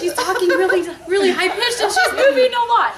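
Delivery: high, loud, laughing take on how he hears her; mock his bewildered report, high and loud with laughter; imitating husband's view. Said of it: "all he knows is".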